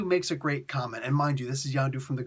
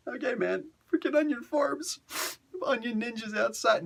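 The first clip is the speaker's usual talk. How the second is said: much higher, pretend choking up with tears; mock teary voice; 10 semitones higher than usual. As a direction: fake being too moved to speak. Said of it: "broken down and started".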